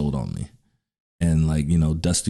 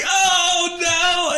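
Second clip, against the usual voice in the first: high mock cry of dismay; mock yell. Wrestler knocked to floor, high mock yell. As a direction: yelp as you hit the floor. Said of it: "and I'm like".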